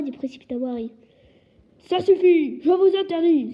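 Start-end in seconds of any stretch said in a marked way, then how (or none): 1.8-3.5 loud, higher panicky outburst; panicked shout. A narrator's reading. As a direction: shout to stop him, in a panic. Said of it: "s'exclama l'oncle Vernon pris de panique".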